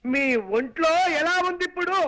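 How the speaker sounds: too loud. Acted. Son-in-law repeating himself to deaf mother-in-law, too loud. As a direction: call it out for someone hard of hearing. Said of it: "అంత గట్టిగా అరవకు నాయనా".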